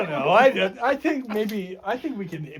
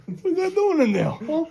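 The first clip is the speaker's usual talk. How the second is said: much higher, squeaky ghost taunt; high mock ghost voice; 11 semitones higher than usual. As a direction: pitch it way up as the ghost.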